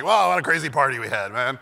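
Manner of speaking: loud faked amazement; put-on excited party-guy bluster; mock party enthusiasm. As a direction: play the thrilled partygoer, loudly. Pretending he partied, quoting himself.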